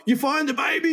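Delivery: high-pitched urgent question; imitating searching driver. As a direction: act out someone calling from a car. Voices driver pulling up asking.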